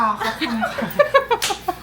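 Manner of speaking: loud laugh. Real, not acted. Laughs before presenting.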